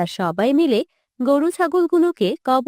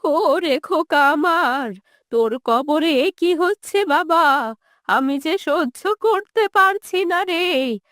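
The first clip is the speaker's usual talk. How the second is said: put-on old mother crying out for her son; acted, quavering grief; tearful wail; 3 semitones higher, slower than usual. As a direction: wail it, stretching out the phrase ends. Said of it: "কেঁদে ওঠেন".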